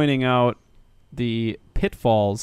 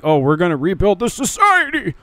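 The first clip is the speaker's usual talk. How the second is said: mock-enthusiastic proclamation; 5 semitones higher than usual. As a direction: pitch climbing to a high finish. Voicing the alien's grand plan.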